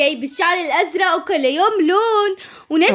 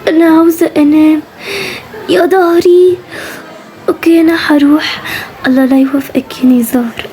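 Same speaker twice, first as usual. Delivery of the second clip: exhausted; 7 dB louder than usual. Acted. Exhausted dragging to school.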